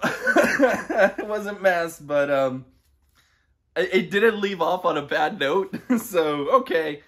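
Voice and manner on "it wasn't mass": slow, high, laughing it off; laughing through a drawn-out line; laughing disbelief. Real, not acted.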